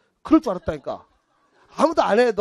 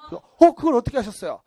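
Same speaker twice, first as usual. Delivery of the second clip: louder, gullible astonishment; mock amazement; 3 semitones higher, 5 dB louder than usual. Gullible woman amazed at palm reader's guess, louder mock surprise. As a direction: gasp as if utterly taken in.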